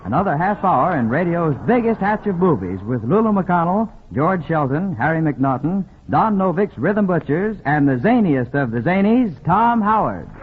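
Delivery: ordinary talk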